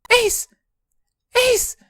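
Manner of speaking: calling out. Acted. June calls him.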